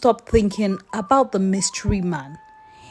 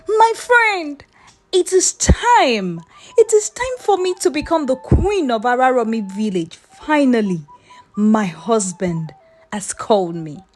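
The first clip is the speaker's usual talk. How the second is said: giddy excitement; 3 semitones higher, 3 dB louder than usual. Acted. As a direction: crow it out like the crown is already yours. Labake jumps up happily.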